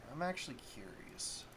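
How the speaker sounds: whispered aside to himself; whispering. Real, not acted. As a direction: whisper it, curious. Musing aside while checking stats.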